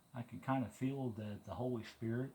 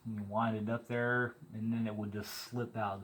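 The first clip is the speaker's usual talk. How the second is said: normal talking voice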